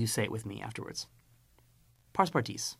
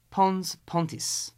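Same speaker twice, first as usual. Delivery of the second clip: "Pons, pontis" drawn out slowly, both words separated; slow drill; 6 semitones higher, slower than usual. Shown as a demonstration. Teacher modelling "Pons, pontis" slowly for the class. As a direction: space out "Pons, pontis" for pupils to copy. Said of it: "vocabulary drill".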